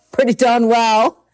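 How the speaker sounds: pretty darn well, said through a real laugh. Real, not acted. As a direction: chuckle it out, amused at the understatement. Laughing at her own understatement.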